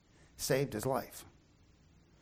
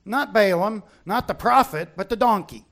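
usual speaking voice

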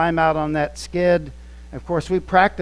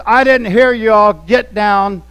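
loud, higher barking command; drill instructor yell; 3 semitones higher, 8 dB louder than usual. Retold or imitated. Instructor punishing trainees for not being loud enough, a loud yell. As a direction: shout it down at the recruits like a drill sergeant.

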